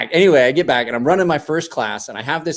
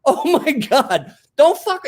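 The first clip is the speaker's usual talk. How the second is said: shocked gasp; 13 semitones higher, much slower than usual. Retold or imitated. Retelling shocked warning.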